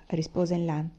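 ordinary delivery

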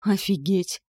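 stunned, exhaled exclamation; exhaled amazement. A narrator's reading. Man behind her seeing Death felled, exhaling in shock. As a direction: let it out on an astonished breath. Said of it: "выдохнул за моей спиной Нитхек".

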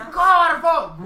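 loud, drawn-out yell of alarm; shout. Acted. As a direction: bellow it out slowly. Shouting at a crow in game.

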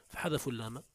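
ordinary talk

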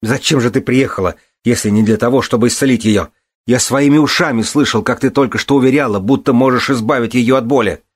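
high, loud accusation; furious protest. A narrator's reading. Son demanding why the healer came, furiously. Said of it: "яростно протестовал Балин".

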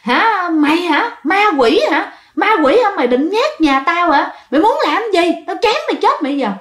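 high-pitched, acted furious challenge; angry threat. Enraged husband with a kitchen knife threatening the ghost, acted angry. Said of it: "tức quá, tức quá mới cầm".

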